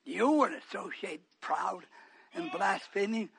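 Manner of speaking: low soft searching questions; hushed questioning. Real, not acted. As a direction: probe them gently, almost whispering. Quiet probing questions.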